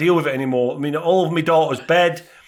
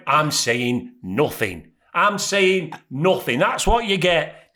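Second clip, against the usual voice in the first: very slow, deliberate delivery; smug restraint; 3 semitones lower, much slower than usual. Retold or imitated. Husband to wife scrubbing the rug, slow smug deliberation. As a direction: spell it out word by word, pointedly.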